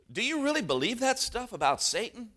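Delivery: a hint of a curious little boy asking; boy's voice.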